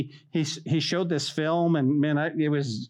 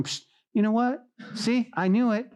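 lightly voiced, higher-pitched sly tempter; a higher, needling aside; taunting enemy; 7 semitones higher than usual. Enemy creeping up on a struggling believer, lightly taunting. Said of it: "enemy comes in and he starts going".

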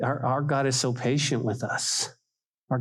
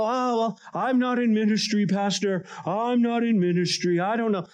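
mock excuse; 7 semitones higher than usual. Excuse voiced by churchgoers.

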